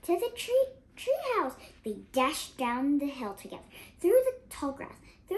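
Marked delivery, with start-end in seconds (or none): 0.0-1.6 loud, high urgent shout. Acted. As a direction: yell the way to safety. Fleeing dinosaur, shouting directions.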